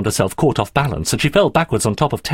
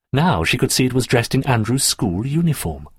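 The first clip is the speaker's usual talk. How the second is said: ordinary delivery, nothing marked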